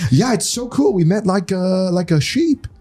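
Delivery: high, delighted mimicry of the guide; imitating Mario. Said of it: "And then he's like".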